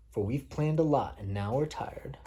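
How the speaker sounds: usual speaking voice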